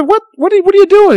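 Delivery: retold startled outburst; alarmed question. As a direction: blurt it out in alarm at the strange device. Alarmed at genie pulling out phone.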